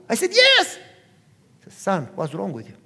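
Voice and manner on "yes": high, loud shout of yes; acted-out shouted confirmation; emphatic excited yes. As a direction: yell it in exasperated joy. Son answers dad emphatically.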